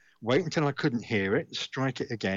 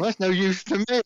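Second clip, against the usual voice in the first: exasperated; 8 semitones higher, 5 dB louder than usual. Retold or imitated. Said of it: "So I thought".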